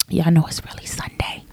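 hushed, whispered aside; confiding whisper. Pretend. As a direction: whisper it like an open secret. Confides the real recording day.

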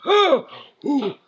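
high pained cry easing off; pained groan. Retold or imitated. Daughter catching her breath after the crash, fading pained cry. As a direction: let the pain fade out. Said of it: "she goes".

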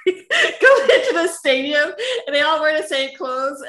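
laughing. Real, not acted. Guest describing Olympic opening parade to actor, laughing. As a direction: keep talking while laughing out loud.

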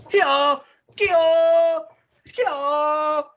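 long, high-pitched mock kiai; stretched, squealing karate yells in imitation; drawn-out karate cries. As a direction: wail it like an over-eager martial artist. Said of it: "doing karate noises".

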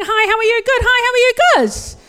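high, loud, hammed-up Kiwi cheeriness; perky Kiwi greeting. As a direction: chirp out small talk in mock-Kiwi politeness. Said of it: "We say things like".

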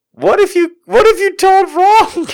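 very high, mock-anxious squeak; hammed-up panic; panicked squeal. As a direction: fret aloud in a squealing falsetto. Acting out worried thought.

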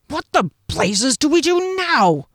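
high, exclaimed frustration; exasperated demand. A narrator's reading. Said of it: "demanded Janek of Jeskellen".

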